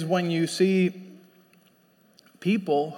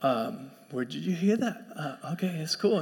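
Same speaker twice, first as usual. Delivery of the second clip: dad staying calm; 3 semitones lower, 9 dB quieter than usual. Retold or imitated. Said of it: "my dad's face just, he's like".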